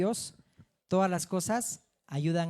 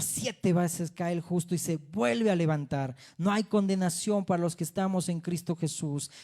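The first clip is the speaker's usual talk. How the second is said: ordinary talk, nothing marked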